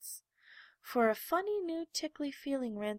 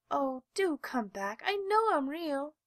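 pleading; 6 semitones higher, 3 dB louder than usual. A narrator's reading. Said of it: "called the little rabbit".

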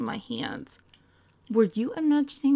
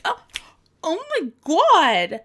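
startled gasp; 7 semitones higher, 9 dB louder than usual. Acted. Startled mid-call.

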